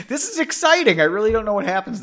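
excited. Real, not acted. Reader excited about chapter.

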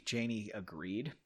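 plain reading voice

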